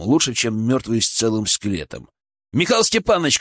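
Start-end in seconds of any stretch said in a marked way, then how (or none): 2.5-3.4 higher-pitched, firm address; commanding. A narrator's reading. Medic addresses colleague mid-compressions, commanding. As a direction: summon a colleague to act. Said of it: "командовал я".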